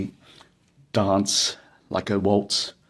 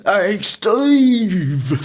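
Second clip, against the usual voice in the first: high, loud, lightly voiced mate; Troy's greeting; 12 semitones higher, 6 dB louder than usual. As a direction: toss out a chummy "hey". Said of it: "he sees me and he goes".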